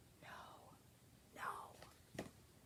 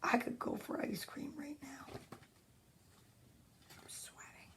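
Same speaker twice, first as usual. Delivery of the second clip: whispering; 22 dB quieter than usual. Real, not acted. Whispered aside about the heat.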